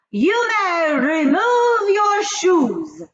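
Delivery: high, loud, very slow command; barking witch. A narrator's reading. Grand High Witch orders her witches to remove their shoes, slow barked command. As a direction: bark it out, stretching every word. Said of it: "barked the Grand High Witch".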